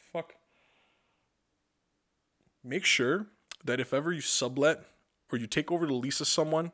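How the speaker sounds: ordinary talk, nothing marked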